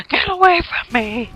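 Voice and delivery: mock shout while going on a rampage; rampage yell. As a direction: yell wildly at the enemies. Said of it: "I'm just like".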